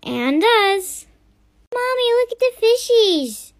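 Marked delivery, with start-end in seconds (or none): 1.7-3.5 put-on high, childlike voice; little kid voice. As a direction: play a small child pointing at fish. Child voice in family skit.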